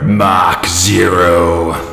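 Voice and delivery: very slow, low, drawn-out title call; dramatic title announcer. Pretend. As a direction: boom the title like a movie trailer.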